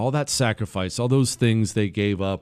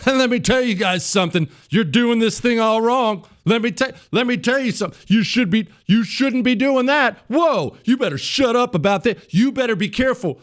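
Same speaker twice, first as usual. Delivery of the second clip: much higher, bossy mocking lecture; lecturing newcomer; 11 semitones higher than usual. Fake convert lecturing the right, much higher mock bossiness. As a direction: parody a know-it-all scolding the old guard. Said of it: "they'll be telling you what's wrong with you".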